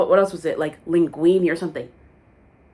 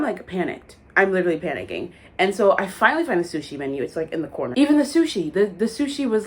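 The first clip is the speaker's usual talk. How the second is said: normal talking voice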